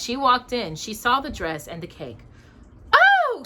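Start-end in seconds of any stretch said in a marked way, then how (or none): 2.9-3.4 high, loud startled oh; surprised gasp. Acted. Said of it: "she gasped".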